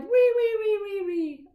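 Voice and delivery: high squealing wee wee; piggy squeal. Acted. Finger play for a child, piggy squealing high. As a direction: squeal like a little pig. Piggy cries all home.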